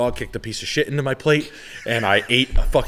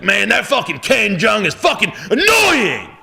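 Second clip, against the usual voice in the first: high, drawn-out mock shout; wife impression; 12 semitones higher, 6 dB louder, slower than usual. Husband imitating his wife griping about a TV judge, loud impression. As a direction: holler it slowly like an exasperated wife. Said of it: "That's how she said it?".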